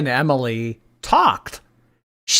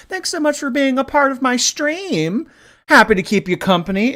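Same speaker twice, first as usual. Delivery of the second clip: much higher, bright thank-you; high bubbly thanks; 12 semitones higher, 4 dB louder than usual. Acted. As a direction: gush thanks to the viewers. Thanking viewers on stream.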